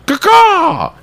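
high, loud cawing bird call; sharp high bird cry, full volume; crow caw. Retold or imitated. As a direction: squawk it out like a crow. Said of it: "this guy literally goes".

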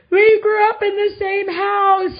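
mock self-important fifteen-year-old, high, loud and slow; smug teen. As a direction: show off like a proud teenager. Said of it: "watching the show and being like".

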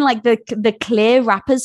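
normal talking voice

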